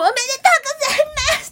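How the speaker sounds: high, bright shop-clerk cheer, laughing; mock cheerful congratulations. Clerk cheering at the winner, imitated with a laugh. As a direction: gush the congratulations like an overexcited clerk. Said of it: "ってすごい言われたから".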